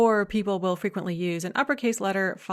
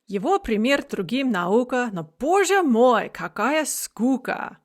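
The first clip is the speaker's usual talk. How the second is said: higher, louder Russian verse reading; poem recitation; 5 semitones higher, 5 dB louder than usual. Shown as a demonstration. Teacher reciting the opening stanza of Eugene Onegin, emphatic verse recitation.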